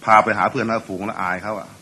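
ordinary talk

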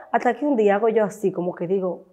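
normal talking voice

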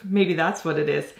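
ordinary talk, nothing marked

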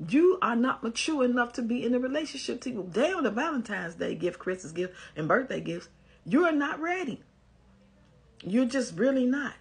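real exasperation, rising to a near shout; exasperated rant. Real, not acted. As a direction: really let her have it. Ranting at the letter writer.

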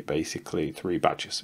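normal talking voice

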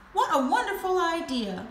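high, lilting exclamation; delighted cry. A narrator's reading. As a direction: cry out at a welcome offer. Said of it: "cried Missus Ostrich".

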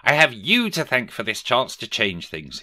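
high, dramatic thanks; grateful exclamation. A narrator's reading. Scrooge thanking the spirits for another chance, exclaiming. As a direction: exclaim it with relieved energy. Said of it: "he exclaimed".

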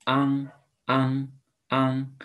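昂 held high with mouth wide open; sounding out words. Shown as a demonstration. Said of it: "your mouth open".

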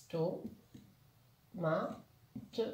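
"To, ma, te" as three separate beats; split into syllables. Shown as a demonstration. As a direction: pause after each syllable in "To, ma, te". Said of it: "Trois syllabes".

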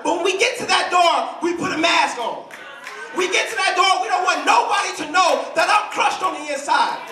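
high, ringing sermon build; impassioned preaching. Real, not acted. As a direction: preach it with rising fire. Preaches at full force.

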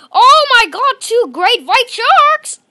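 loud, high, slowed gasp of surprise; amazed exclamation. Real, not acted. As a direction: shout it in disbelief. Spots two great whites.

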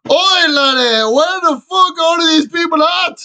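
put-on loud, high Scottish accent calling out. Gamer shouting for missing players, Scottish accent. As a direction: bellow it like a Scottish pub brawler, Scottish accent. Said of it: "he went full Scottish".

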